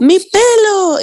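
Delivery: high, slow, drawn-out mock old lady. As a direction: stretch it out like a sweet old lady. Imitating the old lady pointing.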